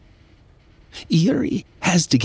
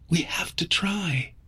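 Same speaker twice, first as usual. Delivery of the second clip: soft voice; 5 semitones higher, 8 dB quieter than usual. Acted. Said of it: "But her voice is soft".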